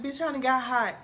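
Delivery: normal talking voice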